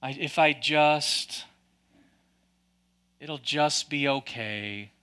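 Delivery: low, slow, droopy gloomy mumble; mopey Eeyore voice. Acted. Preacher mocking the fearful with a mopey drone. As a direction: sigh it out like a defeated donkey.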